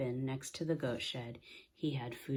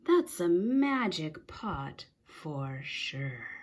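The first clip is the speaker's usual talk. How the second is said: slow, louder amazed remark; awed; 2 semitones higher, 5 dB louder, much slower than usual. A narrator's reading. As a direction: savor the wonder slowly. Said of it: "said Big Anthony".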